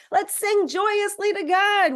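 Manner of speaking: high, loud, drawn-out jubilation; joyous exhortation. Real, not acted. Teacher urges group to sing to God, jubilant.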